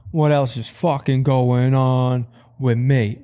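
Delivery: slow, drawn-out musing; slow, self-amused drawl. Shown as a demonstration. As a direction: stretch each word out, pondering aloud. Slowed, drawn-out repeat.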